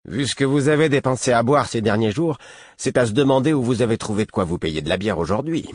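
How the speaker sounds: mock his drinking with contempt; scornful guard. A narrator's reading. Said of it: "grognement de mépris". (garde méprisant et moqueur)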